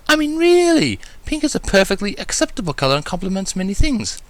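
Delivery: high, refined lady voice, lecturing; lecturing Rarity. A narrator's reading. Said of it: "she started lecturing".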